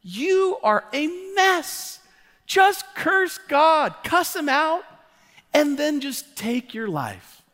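preacher mocking Job's wife's advice, high drawn-out mock voice; mock nagging wife. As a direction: lampoon the fed-up wife, stretching each word. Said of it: "But this is what she says".

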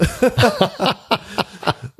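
high-pitched laugh; amused laugh. Real, not acted. Host laughing at own joke about not being old, amused.

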